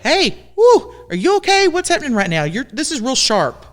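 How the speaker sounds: high, light mock check-in; calling it out. Friend to someone being ugly, high mock-concerned check-in. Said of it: "the second one you're like".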